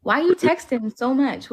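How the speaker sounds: usual speaking voice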